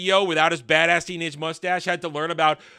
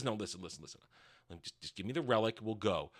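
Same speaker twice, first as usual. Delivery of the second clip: much lower and softer calming voice; mock Elendil; 9 semitones lower, 14 dB quieter, faster than usual. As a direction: talk him down low and soft. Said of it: "Elendil steps in and says".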